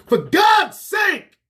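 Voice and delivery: slow, high, loud outcry; drawn-out furious cry for God's sake; exasperated shout. Real, not acted. Climax of rant about begging.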